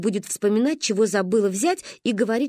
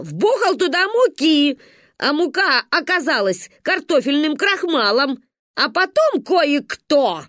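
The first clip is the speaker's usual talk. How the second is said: гневно; 5 semitones higher, 4 dB louder than usual. Acted. Ба гневно рассказывает.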